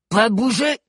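high, screeched command; shouted order. A narrator's reading. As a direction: order your troops to freeze. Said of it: "ordonna Cro-Bleu".

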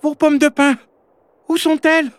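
frantic little creature, pitch way up; panicking. A narrator's reading. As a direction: squeak it in a fluster. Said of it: "Oran s'affola".

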